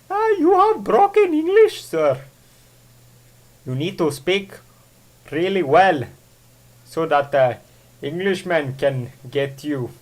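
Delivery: lightly hammed-up foreign accent; playful fake foreign accent lecturing about English; bad put-on accent. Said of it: "I don't know why I keep doing accents".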